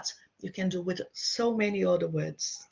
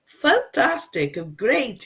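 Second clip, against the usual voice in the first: excited praise; 5 semitones higher, 8 dB louder, slower than usual. Real, not acted. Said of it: "praising a student".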